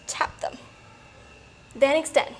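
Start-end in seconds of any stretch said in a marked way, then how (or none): 0.1-0.6 breathy whisper. Real, not acted. Coach cue mid-exercise.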